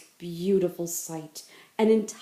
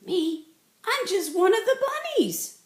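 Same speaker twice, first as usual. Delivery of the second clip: much higher, louder squeak; a little too loudly; 13 semitones higher, 4 dB louder than usual. Acted. Bunny Bear pretending to be a bunny, too loud. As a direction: overdo the bunny voice. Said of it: "a little too loudly".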